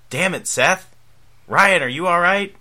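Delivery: loud frustrated yell; shouting. Acted. Ryan screams, Sandy calls out.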